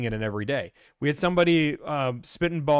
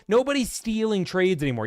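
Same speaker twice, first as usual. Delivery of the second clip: ordinary talk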